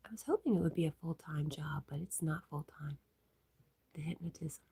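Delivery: soft unvoiced reading of chat; hushed ASMR-like murmur; whisper. Real, not acted. Streamer whispers chat messages to viewers.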